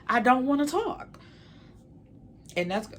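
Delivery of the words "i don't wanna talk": exasperated. Retold or imitated. Exasperated, speaking as Felicia.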